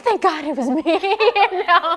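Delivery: bursting into happy laughter mid-sentence; laughing. Real, not acted. Guest laughing with joy.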